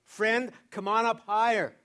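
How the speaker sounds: slow, high, loud call; God's calling voice. Acted. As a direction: call them up like a voice from above. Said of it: "he is saying to us".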